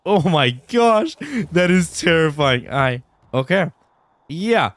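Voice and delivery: high, slow, loud frightened cry; terrified. Real, not acted. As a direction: drag it out, truly scared. Still shaken by the monster.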